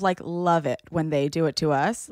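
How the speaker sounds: ordinary talk, nothing marked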